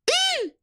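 squeal. Real, not acted. Reacting to the jump scare.

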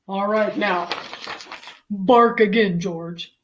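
loud, firm vet order; commanding vet. Acted. Vet telling George to bark again, firm command. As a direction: instruct the patient forcefully.

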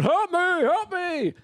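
very high, slow, pleading cry; lion pleading for help. Acted. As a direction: squeal it out like a trapped animal. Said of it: "And he's going".

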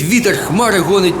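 ordinary delivery, nothing marked

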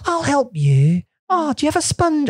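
high, helpful Edinburgh accent. Shown as a demonstration. Helper washing someone, high Edinburgh accent. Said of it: "That's from, um, Edinburgh".

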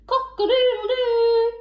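loud, high, sung-out crow; magnificent cockerel crow. A narrator's reading. Cockerel shows off his call, magnificent crow. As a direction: crow it proudly at full voice. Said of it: "showed off his magnificent call".

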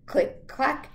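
loud, lively storybook read; picture book read to children. Acted. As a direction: read aloud to the class with gusto. Reads picture book aloud.

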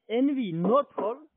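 ordinary talk, nothing marked

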